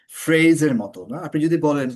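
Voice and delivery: ordinary delivery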